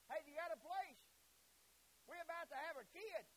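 loud, high call for lodging; urgent plea. Acted. Joseph asking innkeeper for a room, urgent.

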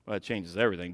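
ordinary talk

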